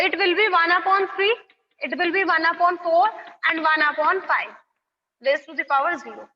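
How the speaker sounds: slow, word by word, for copying; slow dictation. Shown as a demonstration. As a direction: spell it out slowly so they can write. Reading out each step slowly. (धीरे-धीरे लिखवाते हुए)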